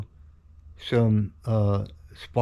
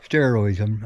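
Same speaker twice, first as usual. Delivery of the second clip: ordinary delivery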